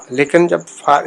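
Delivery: ordinary talk, nothing marked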